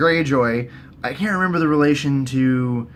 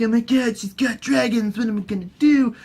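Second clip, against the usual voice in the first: higher-pitched, mildly panicked; mock worry; 7 semitones higher than usual. Cersei learning of Dany's dragons, mild mock panic. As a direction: fret lightly, pitched up.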